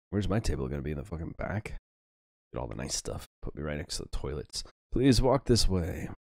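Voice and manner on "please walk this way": snooty waiter. Acted. Maître d' line, slow and pompous.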